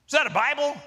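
high, sudden question; curious seatmate. Retold or imitated. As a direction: imitate a passenger perking up at a discovery. Seatmate spots the Bible.

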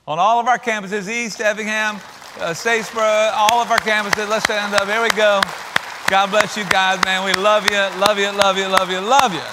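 rally every campus with loud affection; rousing call-out. Real, not acted. Rousing moms on all campuses.

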